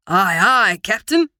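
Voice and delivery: loud hammy pirate. A narrator's reading. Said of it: "attempts his best pirate voice".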